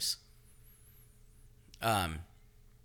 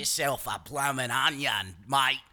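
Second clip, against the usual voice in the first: slow, louder mock Aussie ad voice; hammed-up Australian steakhouse pitch; Outback ad Australian; 3 semitones higher, 5 dB louder than usual. Joking about Australians, mock Outback commercial accent. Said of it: "I think of the Outback guy".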